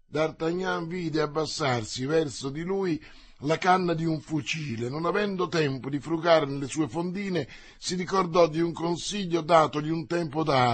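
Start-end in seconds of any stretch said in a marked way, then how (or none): none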